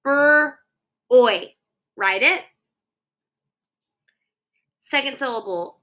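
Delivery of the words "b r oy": Bur-oy split into two louder taps; tapped-out sounds. Shown as a demonstration. Teacher tapping out sounds for kiddos. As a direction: tap each sound of Bur-oy apart. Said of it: "Tap it".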